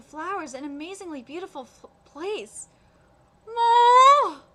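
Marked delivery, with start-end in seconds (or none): 3.5-4.4 loud, drawn-out wail of heartbreak; anguished cry. Real, not acted. Streamer wailing loudly at the game's heartbreaking ending. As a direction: cry out, overwhelmed by the sad ending. Reacting to a sad story ending.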